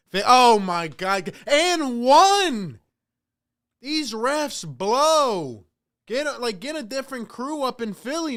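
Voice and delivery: loud, high, real outrage at the refs; outraged outburst. Real, not acted. Fan yelling at the refs over an and-one, outraged. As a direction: explode at the call, drawn out at first.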